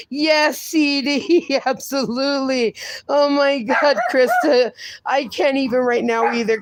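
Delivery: ordinary talk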